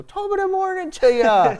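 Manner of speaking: high, loud hammed-up Irish brogue; booming mock-leprechaun Irish lilt; Irish accent. Shown as a demonstration. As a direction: belt out a stagy Irish greeting. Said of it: "trying to do Irish accents".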